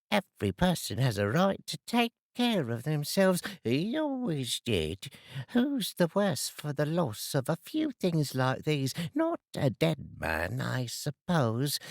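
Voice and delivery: put-on high, scornful old woman; defiant old woman. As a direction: justify yourself with scorn. Woman defends herself.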